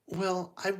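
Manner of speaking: high-voiced Cinderella explaining herself. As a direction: start hesitantly in a high girlish voice. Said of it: "she, Cinderella goes".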